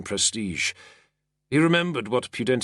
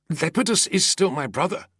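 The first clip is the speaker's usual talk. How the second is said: high, wide-eyed declaration; declared; 12 semitones higher, 3 dB louder than usual. A narrator's reading. Wide-eyed senator declares loyalty to his brother. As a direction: insist on family loyalty. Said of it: "the senator declared, wide-eyed".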